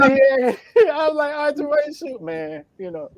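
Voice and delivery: helpless laughter at the friend's story; laughing. Real, not acted. Host cracks up at story.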